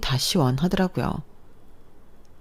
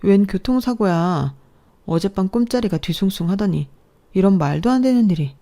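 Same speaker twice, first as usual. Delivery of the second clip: louder, high gasping alarm; shocked dismay; 4 semitones higher, 4 dB louder than usual. Acted. Wife hearing father-in-law was hit by a car, shocked. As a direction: react as if struck by bad news.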